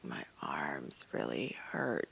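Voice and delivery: faint, slow whispered complaint; weak whisper. Retold or imitated. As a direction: barely whisper it, groggy and slow. Weak patient just woke up.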